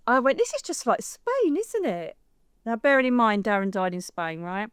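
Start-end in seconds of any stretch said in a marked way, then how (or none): 0.4-2.1 much higher, airy and light; breezy remark. Retold or imitated. Chatting to friends at dinner, breezy and high. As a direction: toss it off brightly.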